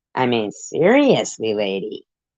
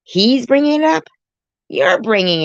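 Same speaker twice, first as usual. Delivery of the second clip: incredulous; 11 semitones higher, 5 dB louder than usual. Real, not acted. Incredulous retort to a viewer.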